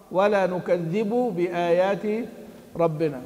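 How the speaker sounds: slow, measured chant of the verse; Quran recitation. Shown as a demonstration. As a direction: recite it slowly, drawing out the words. (تلاوة متأنية مرتلة)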